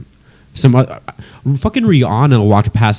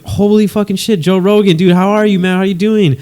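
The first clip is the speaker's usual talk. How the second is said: higher, mock-excited fan gush; imitating a giddy fan, much higher; retold starstruck excitement; 8 semitones higher than usual. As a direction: replay the starstruck thrill, hammed-up. Retelling starstruck reaction on phone.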